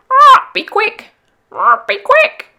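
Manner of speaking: very high, loud, slow squawk; a cockatoo urging the boat on; cockatoo cry. Acted. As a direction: screech it like a cockatoo. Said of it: "the Cocky cried".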